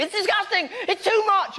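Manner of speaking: high, hurried outburst imitating angry people; mock outrage. Comic mimicking angry public over sex education, mock outrage. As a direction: squeal like an outraged complainer. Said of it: "people are very angry".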